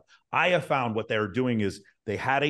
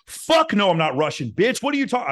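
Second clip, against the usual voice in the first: much higher, much louder, furious denial; defensive shout; 10 semitones higher, 7 dB louder than usual. Shown as a demonstration. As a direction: snap back loud and high, offended. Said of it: "I wouldn't be like".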